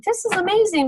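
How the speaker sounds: high-pitched excited gush; retold amazement. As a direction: relive the thrill, pitch jumping up. Recalling first reaction to new item.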